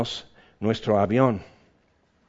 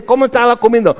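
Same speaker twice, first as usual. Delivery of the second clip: mock exasperated question; 10 semitones higher, 8 dB louder, much faster than usual.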